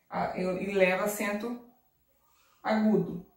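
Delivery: ordinary talk, nothing marked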